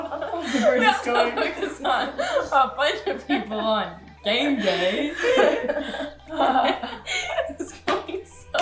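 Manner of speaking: slow, giggling disbelief; laughing. Real, not acted. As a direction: laugh through the words. Amused by friend's odd story.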